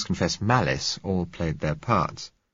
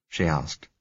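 ordinary delivery

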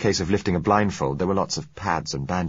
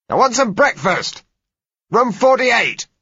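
loud, high-pitched demanding yell; shout; 9 dB louder, slower than usual. A narrator's reading. Bandaged patient demanding breakfast from the passage, loud shout. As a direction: bawl it down the corridor. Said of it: "I shouted".

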